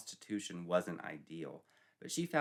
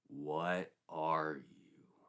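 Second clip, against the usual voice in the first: very slow, low, drawn-out question; ominous sergeant; 5 semitones lower, much slower than usual. Acted. Sergeant asks Sandy what she is, very slow and ominous. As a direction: let each word hang heavily.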